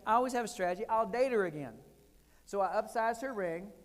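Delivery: usual speaking voice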